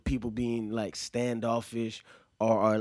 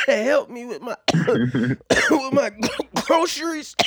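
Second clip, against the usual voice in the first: put-on high, coughing old lady; 12 semitones higher, 7 dB louder, slower than usual. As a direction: croak it out like a sick old woman. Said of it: "she like".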